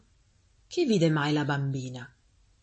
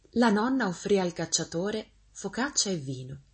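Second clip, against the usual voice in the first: ordinary delivery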